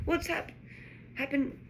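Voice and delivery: high, startled broken question; gasping. A narrator's reading. Ron is staring through the windscreen, gasping. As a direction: gasp it out in shock. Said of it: "Ron gasped".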